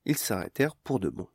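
ordinary delivery, nothing marked

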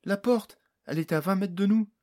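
high, loud, startled outburst; surprised exclamation. A narrator's reading. Fairy spotting the door just above them, exclaiming in surprise. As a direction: cry out in astonishment, quickening. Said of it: "poussa une exclamation de surprise".

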